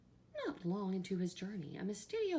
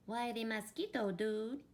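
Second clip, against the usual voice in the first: higher-pitched little dog voice; tiny Chihuahua; 5 semitones higher than usual. A narrator's reading. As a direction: pipe up as the smallest Chihuahua. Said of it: "the smallest of the small ones spoke up".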